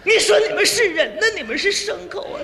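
angry wife. Acted. Wife berates gambling husband, angry. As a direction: berate him as an outraged wife. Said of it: "爱人跟我就火了".

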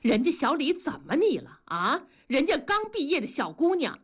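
high, indignant protest; angrier. A narrator's reading. Husband defends the young graduate to his wife, angrily. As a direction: jump to her defence, riled. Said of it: "同志更怒了".

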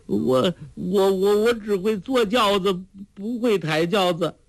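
high, stuttering helpless plea; stammering protest. Acted. Fat lady excuses herself from carrying the sedan, high stammer. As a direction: stumble over the first word, pitched high.

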